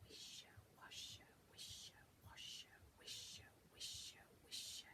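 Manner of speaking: soft, airy rustling whisper; whispering trees. Acted. The wood whispers secrets to the children. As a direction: let the trees breathe it. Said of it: "said the trees in the wood".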